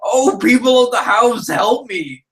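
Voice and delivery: screamed plea for help. A narrator's reading. Startled hunchback calls to the household, high frightened cry. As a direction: call out in startled fear.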